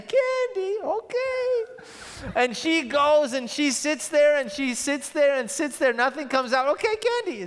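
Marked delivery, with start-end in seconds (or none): 0.0-1.6 put-on very high delighted child voice; eager toddler. Toddler hearing about candy, high and eager. 6.7-7.4 put-on very high delighted child voice; eager toddler. Toddler hearing about candy, high and eager.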